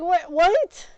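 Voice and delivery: high, loud repeated disbelief; stunned, climbing outrage; shocked what what. Retold or imitated. Told no by school staff, high loud disbelief.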